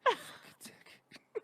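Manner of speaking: breathy under-the-breath fuck you; whispered insult. Retold or imitated. Student cursing teacher under breath, whispered. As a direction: mutter it so the teacher can't hear. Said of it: "You go like this".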